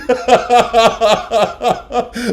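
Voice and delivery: high, loud, helpless laugh; loud laughter. Real, not acted. Husband laughing hard at the memory. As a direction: let the laugh roll out.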